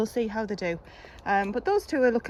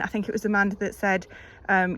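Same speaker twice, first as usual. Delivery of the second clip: normal talking voice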